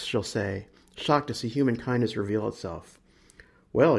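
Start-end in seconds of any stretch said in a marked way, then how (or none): none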